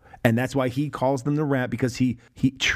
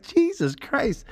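mock awe; 12 semitones higher, much slower than usual. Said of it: "You're like".

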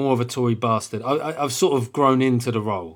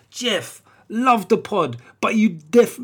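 higher, louder South London urban voice; South London accent; 8 semitones higher, 5 dB louder, slower than usual. Retold or imitated. Comedian reading Ian's review in a put-on South London accent. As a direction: street bruv read of a fan letter. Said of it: "Yes, bruv, South London".

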